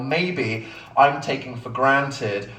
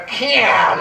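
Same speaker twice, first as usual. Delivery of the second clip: put-on American accent; 9 semitones higher, 6 dB louder than usual. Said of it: "say it in an offensive American accent".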